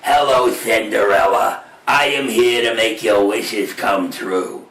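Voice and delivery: deep, slow fairy godmother voice; a low, drawn-out magical helper. Acted. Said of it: "her fairy godmother was like".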